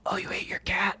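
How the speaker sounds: hushed, unvoiced whisper; acted-out whispered aside; whispered in the ear. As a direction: whisper it like a secret into someone's ear. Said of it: "whispers in your ear".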